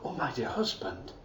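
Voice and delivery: low, soft and sweet. Acted. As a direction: coo it gently to your husband. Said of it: "her voice was sweet and soft".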